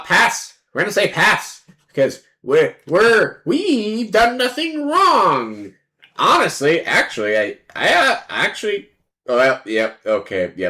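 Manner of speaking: ordinary talk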